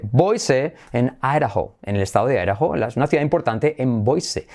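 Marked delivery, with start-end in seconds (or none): none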